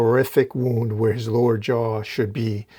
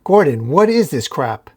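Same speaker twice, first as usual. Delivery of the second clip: higher, louder outcry; retold yell; 4 semitones higher, 6 dB louder than usual. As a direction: yell in disbelief. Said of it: "Batman yells".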